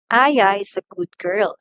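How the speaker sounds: "Aya" punched loud, the rest falling away; emphasize the name "Aya"; stress on Aya. Shown as a demonstration. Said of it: "we stress that one when we pronounce".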